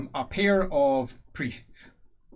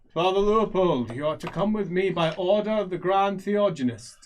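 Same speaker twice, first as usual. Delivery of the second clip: loud, higher, formal summons; raised, official priest voice; commanding inquisitor; 6 semitones higher, 5 dB louder than usual. Acted. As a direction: proclaim an order from high authority.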